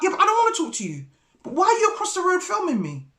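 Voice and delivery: high, fast comeback; retold indignant retort. Answering the neighbour who was filming, fast indignant retort. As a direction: fire back a rapid, high-pitched challenge.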